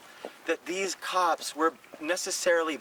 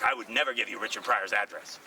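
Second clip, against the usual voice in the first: Paul Mooney impression; 3 semitones lower than usual. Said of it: "He does a great impression of Paul Mooney".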